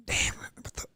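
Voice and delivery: under-the-breath whisper. Real, not acted. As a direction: whisper to yourself. Trying to remember a line.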